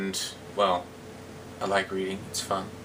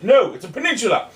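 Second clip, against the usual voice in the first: louder, higher correction; emphatic topper; 8 semitones higher, 6 dB louder than usual. Acted. Cyrano correcting himself to the heckler, louder and higher. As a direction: top the list with a louder flourish.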